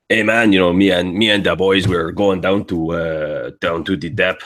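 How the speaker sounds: rough, guttural Quebecois common man; rough Quebec accent. Shown as a demonstration. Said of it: "I tend to lean more towards Quebec".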